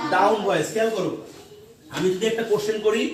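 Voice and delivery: normal talking voice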